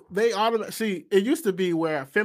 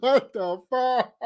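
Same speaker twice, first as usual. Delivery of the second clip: slow, high, stunned what the fuck; higher, slowed mock disbelief; mock shocked Tate; 7 semitones higher, much slower than usual. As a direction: play his baffled face. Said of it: "He's like".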